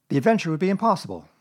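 usual speaking voice

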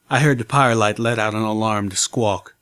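usual speaking voice

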